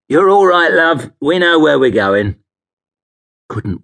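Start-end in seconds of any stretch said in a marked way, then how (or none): 0.1-2.4 high, loud cabbie voice; reassuring cabbie. Acted. Cabbie reassures anxious passenger, breezily loud. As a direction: reassure her in a raised London cabbie voice.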